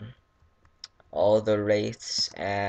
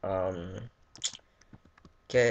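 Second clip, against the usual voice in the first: ordinary talk, nothing marked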